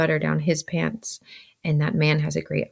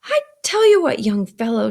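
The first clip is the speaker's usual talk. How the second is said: scolding sister; 6 semitones higher, 7 dB louder than usual. A narrator's reading. Mrs. Joe rises at Pip, scolding. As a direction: launch sharply into a telling-off.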